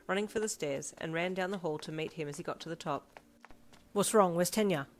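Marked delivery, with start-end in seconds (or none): 3.9-4.9 louder, pressing questions; raised, worried urgency; urgent questioning. A narrator's reading. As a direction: demand answers in alarm. Urgent questions while running.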